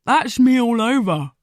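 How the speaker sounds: talking through a mouthful; mouth full. Acted. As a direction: speak round a bite of burger. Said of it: "mouth full of Big Mac".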